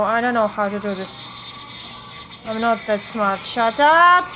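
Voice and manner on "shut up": loud, high snap back at the game; angry shout. Real, not acted. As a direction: yell back, fed up. Game character yelling at her.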